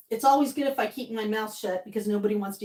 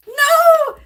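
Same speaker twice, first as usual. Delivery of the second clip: high, loud excited cry of joy; excited shriek; 12 dB louder than usual. Real, not acted. Streamer squealing as old friend Bruce joins chat. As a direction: squeal it with delight at seeing him.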